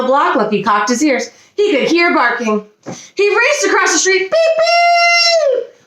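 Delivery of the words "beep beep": very high, held horn imitation; car horn honk. A narrator's reading. Car honking at a puppy in the street, high long beeps. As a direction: beep like a car horn, second one long. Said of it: "A horn honked at him".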